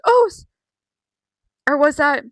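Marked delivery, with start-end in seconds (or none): 0.1-0.4 mock startled gasp. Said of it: "Jay looks over at him and goes".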